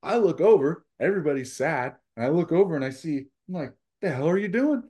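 high, animated imitation of a manager; incredulous, high-pitched retelling; retold amused disbelief.

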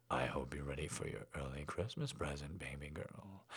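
low, soft and intimate; hushed daddy voice. A narrator's reading. Daddy to his baby girl before the gift, low and hushed. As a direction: murmur it low and close to her ear.

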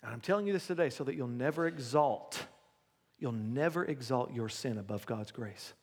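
low, hushed altar-call preaching; quiet preaching. Acted. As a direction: draw them in softly and low. Softens to intimate altar call.

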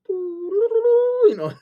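high piping flute sound; flute imitation. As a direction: whistle like a little flute. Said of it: "little flute that goes".

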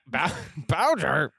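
ordinary delivery

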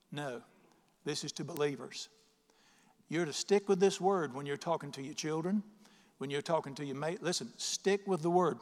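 ordinary talk, nothing marked

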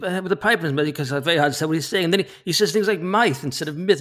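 lightly voiced Tolkien, as if talking round a pipe; a hint of Tolkien mumbling past a pipe; mild Tolkien voice for a friend who asked; Tolkien impression. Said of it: "can we hear a bit of that".

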